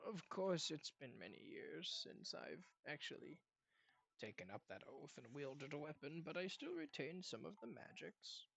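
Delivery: put-on a quiet, weary old knight murmuring; old man Sal. Sal answering the party, hushed and aged.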